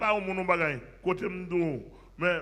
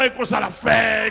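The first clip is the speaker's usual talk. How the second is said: preaching refrain; 3 semitones higher, 10 dB louder than usual. Real, not acted.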